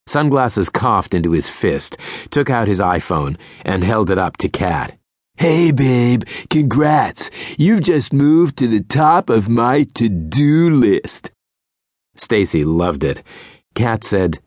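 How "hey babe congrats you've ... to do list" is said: higher, slow smarmy flirt; sleazy pickup voice. A narrator's reading. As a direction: ham the cheesy charmer. Sleazy guy pickup line.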